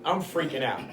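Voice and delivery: ordinary delivery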